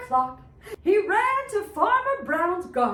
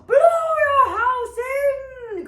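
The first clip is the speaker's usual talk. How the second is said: higher, louder, slow shout; wolf cries out; 8 semitones higher, 6 dB louder, slower than usual. Acted. Wolf shouts his threat at the second pig. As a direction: blow the words out. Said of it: "cried the big bad wolf".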